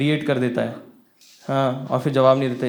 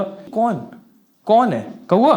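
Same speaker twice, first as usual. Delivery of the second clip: sharp, higher demanding questions; shocked jealous boyfriend demanding a name, angry; angry outburst; 8 semitones higher, 4 dB louder, much slower than usual. Retold or imitated. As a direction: fire off the questions in a jealous rage. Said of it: "इतना गुस्सा आ रहा था".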